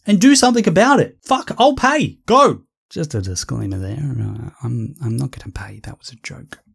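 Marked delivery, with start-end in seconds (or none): 2.9-6.6 much softer, lower aside; quiet aside. Real, not acted. Ranter quietly takes back his joke about paying. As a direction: mutter it off to the side.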